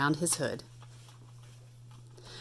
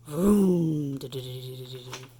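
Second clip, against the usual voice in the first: engine vroom; 5 semitones lower than usual. Acted. Engine noise read aloud.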